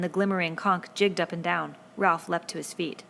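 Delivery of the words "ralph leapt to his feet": usual speaking voice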